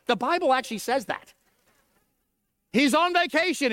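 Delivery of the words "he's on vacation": higher, sarcastic jab at a useless god; mock it like Elijah taunting; lightly mocking taunt. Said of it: "Elijah began to mock 'em".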